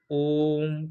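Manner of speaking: ओम with the ओ held long, slow and even; drawn-out Om. Shown as a demonstration. Teacher showing students the pluta length, a long drawn ओम. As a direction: hold the ओ in ओम for three beats. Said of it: "ओ पे प्लुत लेना है".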